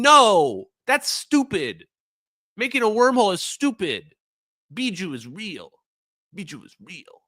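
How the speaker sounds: mocking dismissal.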